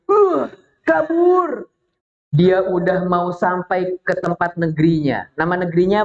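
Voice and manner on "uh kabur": high, drawn-out mock wail; hammed-up panicked getaway call; fleeing cry. Storyteller plays Abraha trying to escape, drawn-out mock cry. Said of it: "Ketika Abraha berusaha kabur".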